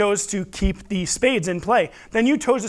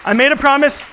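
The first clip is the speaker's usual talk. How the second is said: loud, bright crowd call; kids party magician; 6 semitones higher, 5 dB louder than usual. Acted. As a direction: grab the room's attention. Magician grabbing crowd attention.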